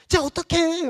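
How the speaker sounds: 훨씬 높고 큰 소리로 아내 흉내; 영화에 몰입해 소리치는 아내를 흉내 내듯; 흥분한 외침. Retold or imitated. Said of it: "막 소리도 지르고". (영화 보며 남편에게 소리치는 아내, 높고 큰 흉내)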